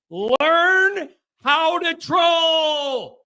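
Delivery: mock-exaggerated slow shout; drawn out. Real, not acted. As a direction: stretch every word out to rub it in. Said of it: "repeated, drawn out higher".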